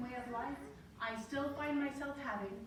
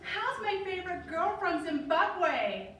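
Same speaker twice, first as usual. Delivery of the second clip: higher, louder, slow sing-song roommate on the phone; chirpy roommate; 6 semitones higher, 5 dB louder, slower than usual. Retold or imitated. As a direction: gush like a too-sweet roommate. Roommate voiced on phone call.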